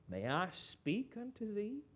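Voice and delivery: softly spoken courteous request; polite, courteous. Acted. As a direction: ask it gently, with great politeness. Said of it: "Do you hear how polite that was?".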